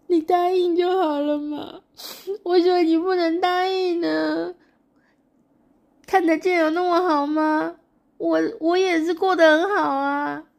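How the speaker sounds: high, loud sobbing voice; sobbing plea. Acted. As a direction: wail and beg him to agree. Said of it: "小情人一直在哭".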